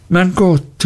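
high-pitched exclamation of disbelief; astonished cry. A narrator's reading. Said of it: "rief Traddles".

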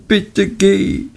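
hohes, betontes bitte; dringender flehend. A narrator's reading. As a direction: das bitte noch dringender betonen, hoch gehalten. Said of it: "Dora war außer sich". (hohes, nachdrückliches „bitte geh“)

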